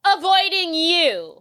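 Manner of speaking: hammed-up mean-girl put-down, drawn out; pointed snub. Gwen snubbing Layla at the party, slow pointed put-down.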